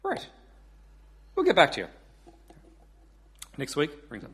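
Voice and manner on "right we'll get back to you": Irish caller backing off; reply in an Irish accent. Acted. Paddy after hearing Kim's numbers, Irish accent. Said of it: "I do do an Irish accent".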